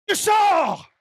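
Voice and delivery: explosive, high, loud order; commanding shout. Retold or imitated. Son ordering the witch out, explosive commanding shout. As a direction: blast her out with full authority.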